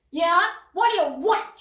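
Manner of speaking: loud, surly snarl. A narrator's reading. Jezebel snarling at Cauldron, loud and surly. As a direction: bark it at him, irritated. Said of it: "she snarled".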